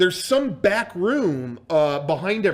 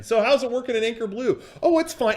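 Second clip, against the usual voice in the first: quick, high-pitched chirpy exchange; hurried mimicry of idle chat; mock small talk; 7 semitones higher, faster than usual. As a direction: parody polite chitchat, pitched up.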